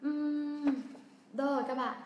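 ordinary delivery

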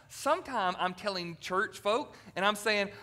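normal talking voice

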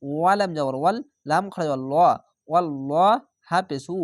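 ordinary talk, nothing marked

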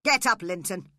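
loud, sharp command; angry shout. A narrator's reading. Heathcliff to his son, shouting angrily. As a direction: shout it as a harsh order. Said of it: "He shouted angrily to his son".

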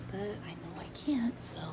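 soft whisper. Real, not acted. Whispering outside about missing the ball game. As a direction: breathe it out without voice. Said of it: "Why am I still whispering?".

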